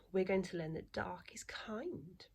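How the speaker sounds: whisper. Acted. Teacher whispers story intro.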